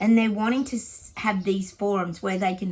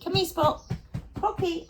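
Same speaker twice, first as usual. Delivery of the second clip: high, sing-song call to a dog; high coaxing call; 10 semitones higher than usual. Real, not acted. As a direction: coax the dog over, high. Calling the dog.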